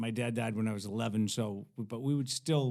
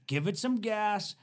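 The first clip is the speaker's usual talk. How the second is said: higher, lightly voiced instruction; imitated driving coach; 8 semitones higher, 3 dB louder than usual. Hippie to teen stopped on steep hill, imitated coaching. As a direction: pitch it up and urge them on.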